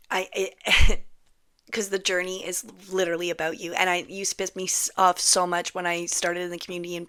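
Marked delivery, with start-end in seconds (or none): none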